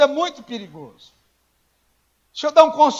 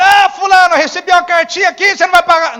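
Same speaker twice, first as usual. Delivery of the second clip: much higher, louder, shouting at the friend; angry shout; 8 semitones higher, 11 dB louder, faster than usual. Pretend. Guarantor shouts at the friend who didn't pay, furious. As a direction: blast him over the phone. Angry debtor call acted out.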